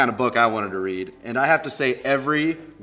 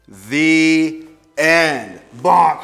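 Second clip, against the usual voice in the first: story ending; 4 semitones higher, 8 dB louder, much slower than usual. Acted. Reads the book's ending.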